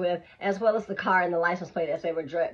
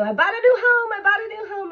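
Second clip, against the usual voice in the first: much higher, loud excited squeal; 7 dB louder than usual. Retold or imitated. Telling her roommate about the new van, thrilled. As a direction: shout it out like you just got a house. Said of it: "I was so excited".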